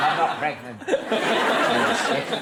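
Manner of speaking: slow, high, laughing denial; laughing protest. Retold or imitated. Host laughing at people touching her stomach. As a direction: laugh it out slowly. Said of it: "and I'm like".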